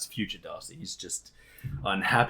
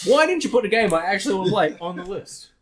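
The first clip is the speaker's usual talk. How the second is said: high, loud, exasperated demand; mock angry scolding; 10 semitones higher, 7 dB louder than usual. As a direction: berate your past self comically. Acted scolding of past self.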